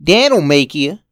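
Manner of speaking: put-on loud boy's retort; angry child. Boy threatens with Dad, loud retort. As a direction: snap back like a sulky kid.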